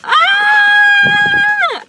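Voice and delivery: very high, loud scream; freaked-out scream. Real, not acted. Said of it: "I'm really freaking out".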